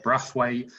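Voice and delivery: Brathwaite with a short flat A; Brathwaite, first syllable like "braff"; made-up pronunciation. Shown as a demonstration. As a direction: say Brathwaite with the first vowel as in bath. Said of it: "You can make up whatever you want".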